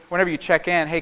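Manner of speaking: ordinary talk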